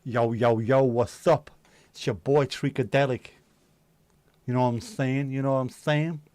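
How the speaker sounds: loud, hammed-up South Central street greeting; mock gangbanger. Joking about gang signs in a bandana, loud mock gangster. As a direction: play at being a gangbanger hyping himself up. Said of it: "Throw in gang signs".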